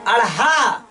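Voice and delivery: अढ़ा ending like हा, breath audible; model pronunciation. Shown as a demonstration. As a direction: make the ढ़ sound like हा. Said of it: "हा नहीं ल-, बोलना है ना, हा जैसा". (अढ़ा को हा जैसी हवा के साथ)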